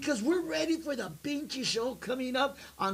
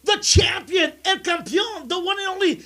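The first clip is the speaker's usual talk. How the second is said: ring announcer; 6 semitones higher, 11 dB louder than usual. Acted. Introducing wrestler guest on show.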